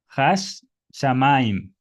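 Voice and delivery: "Hash Shamaim" said slowly, the sh sound held across both words; slow doubled sh. Shown as a demonstration. Teacher modelling for students, slow "Hash Shamaim" with the doubled sh. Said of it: "por eso la pronunciación es".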